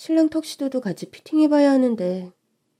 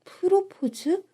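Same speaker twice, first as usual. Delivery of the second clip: 프로포즈 echoed much higher with a sharp rising question; surprised echo; 10 semitones higher, 5 dB quieter, much slower than usual. Acted. Bride echoing her mother's guess, 프로포즈? high and surprised. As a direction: toss back 프로포즈? in disbelief.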